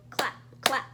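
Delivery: usual speaking voice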